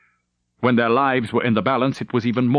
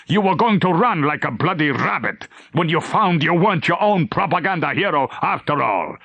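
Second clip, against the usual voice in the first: angry; 6 semitones higher than usual. Acted. Captain berates Leitner.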